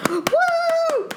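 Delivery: high, cheering whoops of joy; excited cheer. Retold or imitated. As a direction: whoop like you just won. Cheering at the win.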